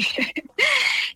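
a high, delighted laugh. Real, not acted. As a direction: laugh at the punchline. Laughing at the doctor story.

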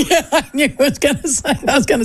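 talking through laughter; laughing. Real, not acted. Wife teasing her husband on air, laughing. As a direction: let the laugh bubble through the words. Laughing at co-host's coattails joke.

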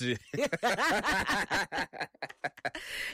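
long high-pitched laugh; laughing fit. Real, not acted. Co-host teased about being wicked, high laughing fit.

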